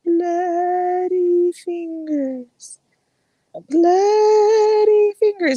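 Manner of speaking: very slow, high, drawn-out ghostly moan; eerie ghost voice. Acted. Storyteller at a campfire doing the ghost's rising moan. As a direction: stretch every syllable like a haunting. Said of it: "he hears".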